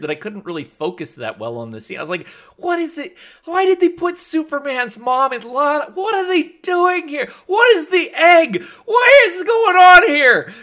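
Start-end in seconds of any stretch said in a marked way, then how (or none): 2.6-10.5 very high-pitched frantic questions; bewildered inner panic. Retold or imitated. Viewer baffled by the scene, bewildered inner panic. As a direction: wail it in mock disbelief, building to a shout. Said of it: "that's what's going through my head".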